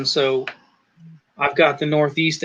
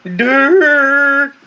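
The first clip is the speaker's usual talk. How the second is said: higher and louder, slow surfer-dude drawl; mock Colorado accent; 8 semitones higher, 5 dB louder, much slower than usual. Teasing his buddy Colorado with a funny dude voice. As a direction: do a goofy dude from New York. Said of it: "you wanna talk funny accent, ... you can hear".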